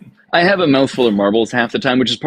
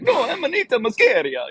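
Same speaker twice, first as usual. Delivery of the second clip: much higher, hammed-up Italian accent on "Amanita muscaria"; heavy Italian accent; 13 semitones higher than usual. Mycologist insisting on Latin names to a listener, exaggerated Italian accent. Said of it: "making fun of Italians".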